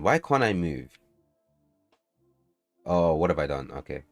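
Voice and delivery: ordinary talk, nothing marked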